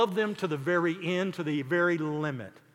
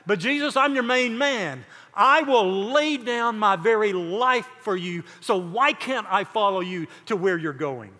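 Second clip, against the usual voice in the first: boastful protest; 3 semitones higher, 6 dB louder than usual. Retold or imitated. Peter tells Jesus he is his main man, insistent protest. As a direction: plead your loyalty at full push.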